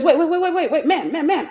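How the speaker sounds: rapid-fire urgent cut-in, imitated; excited interruption. Retelling agent's excited interruption.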